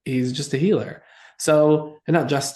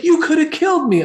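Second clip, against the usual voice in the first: much higher, louder accusing cry; hammed-up alarm at being endangered; mock panic; 6 dB louder than usual. Medium accuses teller.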